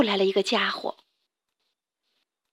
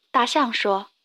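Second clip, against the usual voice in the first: plain reading voice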